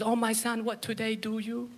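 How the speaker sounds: put-on quiet, faltering accented English; lightly voiced immigrant mother struggling with English; mom's broken English. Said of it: "She goes".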